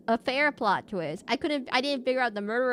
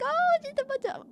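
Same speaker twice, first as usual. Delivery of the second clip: mock surprised. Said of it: "and I was like".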